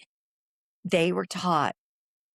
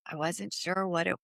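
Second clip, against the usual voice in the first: normal talking voice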